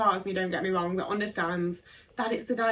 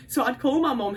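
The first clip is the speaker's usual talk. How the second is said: normal talking voice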